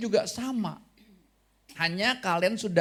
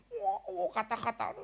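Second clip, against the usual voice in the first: mock amazement; 13 semitones higher, 6 dB quieter than usual. Amazed at child's words.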